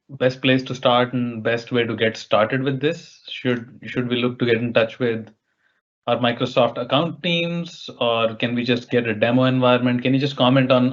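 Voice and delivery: ordinary talk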